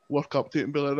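ordinary talk

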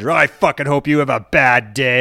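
a half-mocking replay of an angry rant at the phone company; retold angry phone rant. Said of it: "That's exactly what I said".

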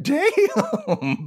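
high laughing "Damn."; amazed laugh. Retold or imitated. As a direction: laugh it out in disbelief. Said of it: "I'm like".

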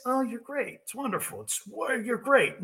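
a hint of syrupy flattery; mock soft flattery. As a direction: coo hollow compliments.

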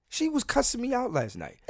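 amused disbelief, laughing it out. Real, not acted. To the listeners, laughing about her cussing him out.

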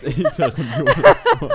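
high, loud, laughing through it. Real, not acted. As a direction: burst out laughing mid-word. Laughing at the casting joke.

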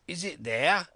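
fierce, high-pitched demand; roared question. A narrator's reading. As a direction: roar it up at the window. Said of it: "roared Pew".

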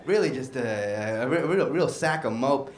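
ordinary talk

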